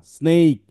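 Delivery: স্নেক said loud and clear as sneik; teacher correction. Shown as a demonstration. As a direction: call out স্নেক firmly for the class. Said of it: "আমি আবার ঠিক করে দিচ্ছি".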